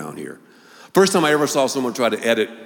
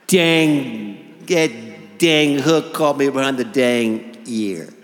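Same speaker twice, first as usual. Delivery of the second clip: slow, drawn-out dentist drawl, louder when flustered; 4 dB louder, slower than usual. Retold or imitated. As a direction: tell the fishing story like a plodding old dentist. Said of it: "had a voice like this".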